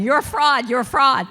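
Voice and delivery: shouting fraud at herself, loud and harsh; mock inner critic yelling; loud self-accusation.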